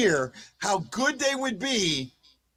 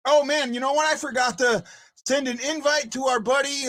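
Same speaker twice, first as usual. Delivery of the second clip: ordinary talk, nothing marked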